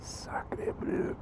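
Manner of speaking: whispered mock French. Real, not acted. Alone recalling the story, whispered mock French. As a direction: breathe it like a private joke. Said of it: "whispered repeat of the joke".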